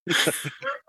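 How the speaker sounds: a short high burst of laughter; high delighted giggle. Real, not acted. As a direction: crack up at the punchline. Reacts to "Am I Jesus.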